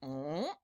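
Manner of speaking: low quiet swallowing gulp; soft gulp noise; gulp sound. Shown as a demonstration. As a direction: demonstrate a big swallow. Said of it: "when you go like this".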